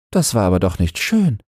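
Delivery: Christine disapproving. Acted. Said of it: "meinte Christine". (Christine, höhere Stimme, leicht vorwurfsvoll)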